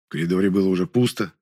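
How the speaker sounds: plain reading voice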